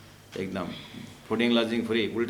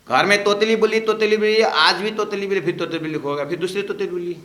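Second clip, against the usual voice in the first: animated exclaiming; 8 semitones higher, 7 dB louder than usual. Real, not acted. Preacher exclaims about lisping talk.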